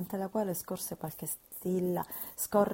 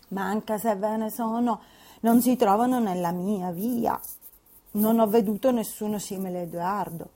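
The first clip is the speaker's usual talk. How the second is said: louder, stubborn retort; Catherine defiant; 3 semitones higher, 8 dB louder than usual. Acted. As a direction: dismiss the idea firmly.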